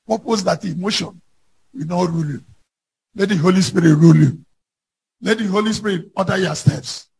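ordinary talk, nothing marked

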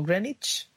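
Greenwich as "GREN-itch", silent w; alternative English pronunciation. Shown as a demonstration. Host offers the other correct form, Greenwich as "GREN-itch". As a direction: say Greenwich as "grenitch", ending in ch. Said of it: "bien pronunciado es".

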